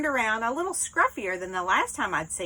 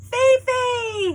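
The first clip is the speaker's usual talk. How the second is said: high, loud call of the name; calling the dog; 13 semitones higher, 6 dB louder than usual. Acted. Woman calling her dog out of the camper, loud and high. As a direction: call the dog over with joy.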